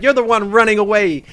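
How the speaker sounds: taunting. Retold or imitated. Cosmo gloating at the Demon Lord as he runs, loud taunt. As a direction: rub it in his face.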